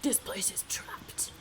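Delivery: slow, whispered mimicry of a game voice; very breathy. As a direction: imitate it in a slow, airy whisper. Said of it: "Voice four is very breathy".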